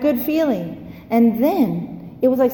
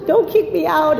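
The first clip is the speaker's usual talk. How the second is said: high, loud retold wail; crying plea; 7 semitones higher, 5 dB louder than usual. Newcomer begging her sponsor not to kick her out, crying. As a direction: beg tearfully, acting out the panic. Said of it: "I'm crying".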